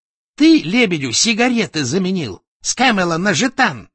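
high, loud, angry charge; furious accusation. A narrator's reading. Enraged boss accuses employee. (гневное обвинение)

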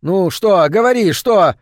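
an impatient husband shouting at his wife; exclaiming. A narrator's reading. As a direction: bark the demand at her. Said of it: "вскричал муж".

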